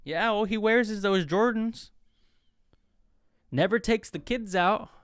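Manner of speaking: hammed-up auntie griping about her man; complaining. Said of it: "Auntie's complaining about their man".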